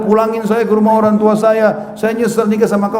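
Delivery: high-pitched mock marital outburst; harsh-talking wife. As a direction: imitate an upset wife lashing out. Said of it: "kalau dia sudah ngomong kasar". (menirukan istri bicara kasar)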